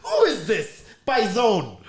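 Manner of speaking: high laughing put-on voice; laughing mock rant. Mocking the soldier's reception, laughing high rant. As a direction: rant it in a squeaky voice through laughs. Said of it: "he's like".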